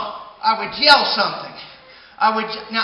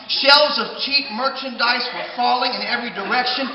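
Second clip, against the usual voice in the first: ordinary delivery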